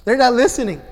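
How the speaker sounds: father imitating his past frustration at kids; mock frustrated dad. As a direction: ham up the exasperated father. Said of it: "I'm laughing at myself".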